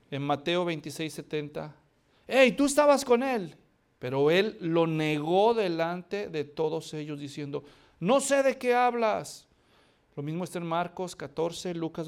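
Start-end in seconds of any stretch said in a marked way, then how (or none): 2.3-3.6 loud accusation. Acted. Another accuser. 8.0-9.5 denial. Acted. Peter denies.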